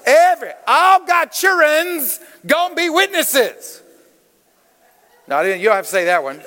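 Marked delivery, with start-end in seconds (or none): 0.6-3.8 high, emphatic preacher's folksy dialect; folksy bad-English dialect. Preacher rallying church to be witnesses, folksy dialect. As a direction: proclaim it in deliberately ungrammatical folksy dialect. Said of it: "it's bad English".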